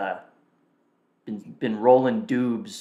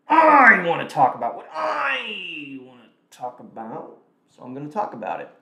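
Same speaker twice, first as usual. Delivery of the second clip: mock tantrum; 3 semitones higher, 4 dB louder than usual. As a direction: wail it like a defiant child. Mock tantrum about his own stream.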